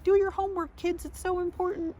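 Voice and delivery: mock nagging parent.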